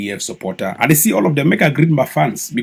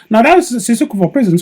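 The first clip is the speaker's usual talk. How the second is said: mock American accent; 11 semitones higher, 6 dB louder than usual. Vlogger parodying a politician's phone call, high mock American accent. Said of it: "you hear accent".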